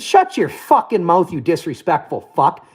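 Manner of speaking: slow, deliberate put-down after a long pause; cold, measured insult after a dramatic beat. Retold or imitated. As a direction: let the pause hang, then lay it out slowly. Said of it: "I did a Justin Trudeau dramatic pause".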